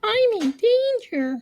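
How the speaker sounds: very high, slow, loud goofy voice; meme quote. Retold or imitated. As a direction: squeak it out like a panicked cartoon kid. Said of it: "I always end it with".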